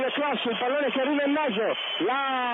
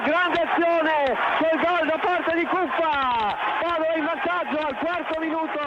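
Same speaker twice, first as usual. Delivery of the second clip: excited goal call; goal shout; 6 semitones higher, 6 dB louder than usual. Real, not acted. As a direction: roar the goal.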